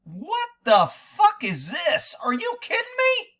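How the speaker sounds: slow, then shooting way up in disbelief; what the fuck, then a stunned high cry. Retold or imitated. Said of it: "I was like".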